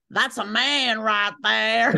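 lightly Southern, slow drawn-out praise with the word man stretched; a hint of a Southern drawl, slow and proud; Southern accent. Student praising the husband, slow mock Southern accent. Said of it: "sounded like a Southern accent".

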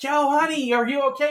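high calling out "Yo, honey"; calling up the slope. Retold or imitated. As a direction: call up the mountain to her. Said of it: "So I gotta yell back to her".

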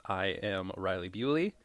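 ordinary talk, nothing marked

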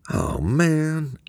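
high, drawn-out whine; scared friend whining, high and long. A narrator's reading. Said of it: "Eamon whined".

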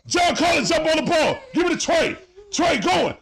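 hollering instructions at the screen; yelling at the TV. Real, not acted. Fan shouting at the Hawks players during a fast break, yelling.

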